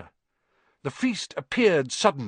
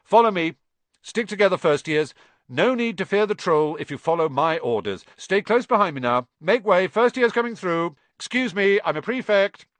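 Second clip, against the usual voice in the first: loud, high, self-important orders; pompous prefect; 6 semitones higher, 5 dB louder than usual. A narrator's reading. Percy leading first years through the crowd, pompous and loud. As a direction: bark orders, enjoying the authority.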